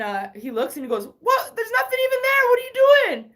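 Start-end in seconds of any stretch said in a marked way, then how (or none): 1.2-3.3 high, loud disbelieving what; bewildered dad, much higher and louder; baffled dad. Retold or imitated. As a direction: shout it in confusion. Dad baffled looking at ground.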